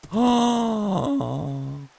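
long high-pitched gasp of delight; astonished gasp. Real, not acted. Player thrilled by the horse, long high gasp. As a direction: gasp high, letting it trail out.

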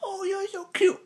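very high cutesy pet-talk voice; cooing at a cat. Real, not acted. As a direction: coo it sweetly at the cat. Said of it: "I talk to it like".

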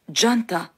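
ジャンタ as "JAN-ta", with an English J; variant pronunciation. Shown as a demonstration. Teacher listing other pronunciations, ジャンタ with a J sound. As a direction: say ジャンタ with a J and an "ah" vowel. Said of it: "と発音する人もいます".

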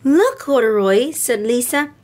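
gentle storybook read-aloud with voices; storybook reading for little girls; reading to children. A narrator's reading. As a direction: read it like bedtime story time. Reads picture book to girls.

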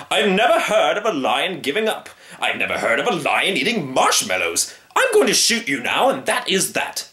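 high, emphatic bluster; pompous hammed-up threat; indignant hunter. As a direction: pile up objections, then lay down the law. Hunter refuses the lion indignantly.

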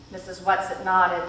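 ordinary delivery

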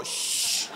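soft, drawn-out shushing; hushing shh. Acted. Fiancee with a pillow to Hefner, soft shush. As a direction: hush him softly, as if putting him down.

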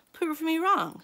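high, loud dare; loud challenge. Acted. Sally loudly daring Kirby to prove it. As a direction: throw down the challenge. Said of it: "Sally challenged".

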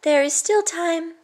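put-on high, kind boy's voice; boy reassuring the princess. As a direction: answer simply with a smile.

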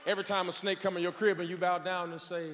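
ordinary talk

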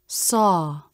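"Saw" long, with falling pitch; stressed SAW. Shown as a demonstration. Teacher demonstrating pure stressed ah, "Saw" long and fully stressed. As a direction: give "Saw" full length and stress. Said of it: "Pure stressed ah".

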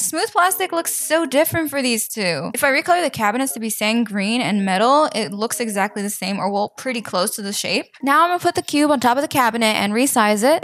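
usual speaking voice

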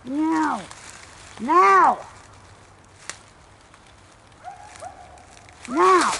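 high, loud sharp command; calling from far away. Real, not acted. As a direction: bark the order at the far dog. Commanding dog repeatedly.

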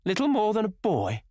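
mock his words with a bitter sneer. A narrator's reading. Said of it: "she sneered".

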